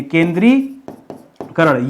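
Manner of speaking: केंद्रीकरण drawn out very slowly, syllable by syllable; slow spelled demo. Shown as a demonstration. Teacher dictating केंद्रीकरण to students, very slowly. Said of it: "सिर्फ केंद्रीय लिखिए".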